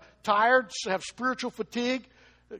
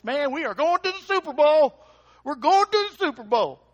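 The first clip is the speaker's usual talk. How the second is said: higher, louder gleeful cheer, voiced like a text message read aloud; excited fan; 6 semitones higher, 7 dB louder than usual. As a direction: crow it like your team just won. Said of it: "we got a text that night".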